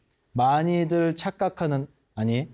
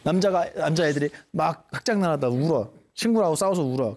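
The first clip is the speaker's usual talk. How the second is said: ordinary talk, nothing marked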